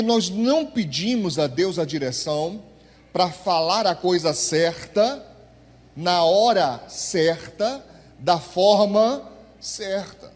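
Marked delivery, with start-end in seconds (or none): 3.1-10.3 each phrase stretched and weighted; slow emphatic preaching. Acted. Pastor drilling the congregation, slow and emphatic. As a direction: drag out every phrase for the congregation.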